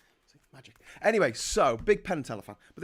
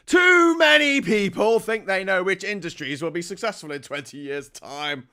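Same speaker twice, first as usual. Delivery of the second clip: mocking impression, blared out then easing down; Jacob Rees-Mogg; 4 semitones higher, 8 dB louder than usual. Vlogger mocks a politician's article on industries, loud caricature. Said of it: "This is Jacob Rees-Mogg".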